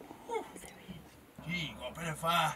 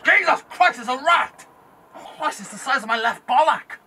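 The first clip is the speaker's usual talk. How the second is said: loud high alarmed yell; startled shouting; 12 semitones higher, 15 dB louder than usual. Real, not acted. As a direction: yell in shock at a rat. Startled by a rat.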